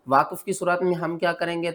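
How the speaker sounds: normal talking voice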